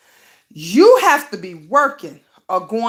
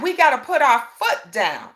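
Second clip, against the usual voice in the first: ordinary delivery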